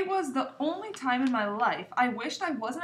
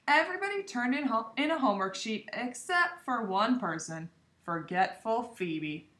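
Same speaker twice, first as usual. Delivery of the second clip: ordinary delivery, nothing marked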